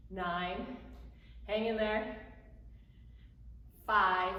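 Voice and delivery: ordinary talk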